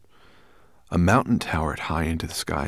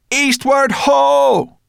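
long, high, loud holler; rallying call; 11 dB louder, much slower than usual. A narrator's reading. Sorrel steering the dragon east, rallying call. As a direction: stretch it out like a lookout's cry. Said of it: "Sorrel called".

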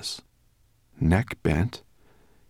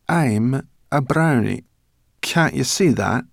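slow, high, louder and proud; proud brownie; 6 semitones higher, 6 dB louder, slower than usual. Acted. Brownie proudly telling the boy what she is. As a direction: state it as if it were obvious.

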